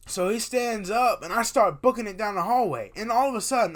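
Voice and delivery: loud, high, worked-up storytelling; excitable dream recap at high volume; animated storytelling. Acted. Retells the dream chase.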